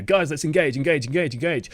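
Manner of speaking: brisk, repeated rallying call; decisive, projected call; engaged shot call. Shown as a demonstration. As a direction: urge the team in, confidently. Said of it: "which one are you more likely to respond to".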